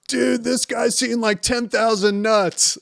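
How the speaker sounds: high, giddy, giggling delivery; giggly laughing-gas guy. Retold or imitated. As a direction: imitate a patient cracking up on laughing gas. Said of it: "he just starts laughing and goes".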